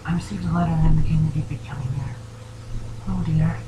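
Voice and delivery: quick, gently serious character voice, lifted in pitch; Fluttershy. Acted. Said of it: "She nods solemnly".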